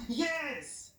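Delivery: high, drawn-out answering call; Daniel calling back. Acted. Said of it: "when Daniel responds back".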